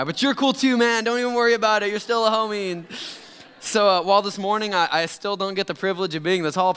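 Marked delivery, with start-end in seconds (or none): 0.0-2.7 high, loud mock-enthusiastic buddy voice; over-eager reassurance. Caller consoling the short pastor after his mistake, over-eager reassurance. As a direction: rush in to cheer him up, hammed-up.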